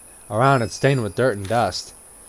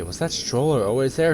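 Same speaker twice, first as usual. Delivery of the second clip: usual speaking voice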